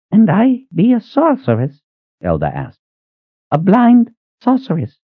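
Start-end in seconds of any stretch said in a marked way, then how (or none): 0.1-1.8 put-on old Elda. 3.5-5.0 put-on old Elda.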